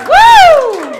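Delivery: high, loud crowd-hyping woo; excited whoop. Real, not acted. Comic hyping the crowd before her story, a loud high whoop. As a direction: whoop to pump up the room.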